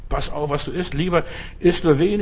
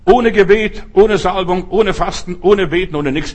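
preaching emphasis; 5 semitones higher, 7 dB louder than usual. Real, not acted. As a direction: hammer each "ohne" louder. Preacher listing, building emphasis.

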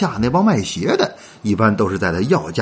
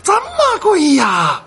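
feigned shock; slower than usual. Shown as a demonstration. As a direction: gasp at the price as if it's outrageous. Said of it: "你也要一脸吃惊的说".

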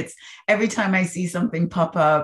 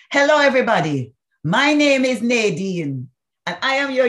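imitating a Jamaican yoga teacher's greeting, higher and louder; a bright, warm Jamaican welcome to the class; Jamaican accent; 8 semitones higher, 5 dB louder than usual. Speaker imitates the yoga instructor greeting the class, in a Jamaican accent. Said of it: "this thick Jamaican accent".

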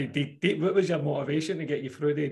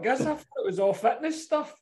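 laughing through the words, pitched higher; 10 semitones higher than usual. Real, not acted. Mate laughing at his own naivety about training. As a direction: giggle while admitting it.

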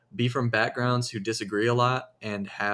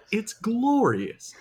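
mock gushing; 11 semitones higher, much slower than usual. Mock praise for Wendy's Twitter.

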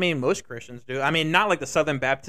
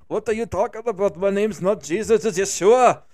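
put-on higher, indignant voice with a Russian accent; Russian-accented Jesus; 4 semitones higher, 5 dB louder than usual. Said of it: "why am I giving Jesus a Russian accent".

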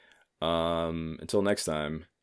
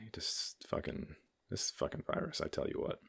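muttered under breath; 14 dB quieter than usual. Real, not acted. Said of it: "get that off my chest".

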